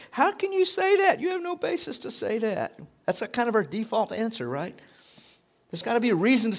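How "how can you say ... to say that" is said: very high-pitched protest; mock incredulity. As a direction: squeal in disbelief at empty comfort. Incredulous retort to movie line.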